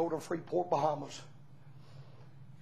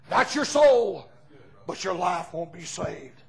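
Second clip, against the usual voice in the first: normal talking voice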